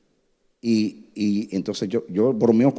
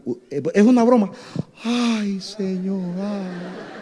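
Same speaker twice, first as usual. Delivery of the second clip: put-on relieved girl; 9 semitones higher, slower than usual.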